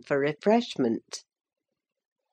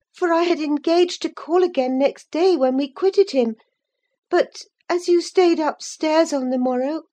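put-on high-pitched, earnest girlish voice; young Catherine confessing; 10 semitones higher, 3 dB louder than usual. A narrator's reading. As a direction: pour out the confession like an eager young girl.

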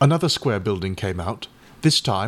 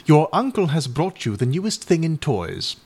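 impressively; 7 semitones higher, 3 dB louder than usual. A narrator's reading. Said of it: "Eleanor had said impressively".

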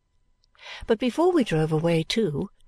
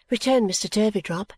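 plain reading voice

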